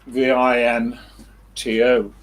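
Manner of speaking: V I N T O spelled letter by letter, loud; letters of V I N T O called out one at a time. Shown as a demonstration. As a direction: spell V I N T O slowly, each letter clear. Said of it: "spelling out Vimto".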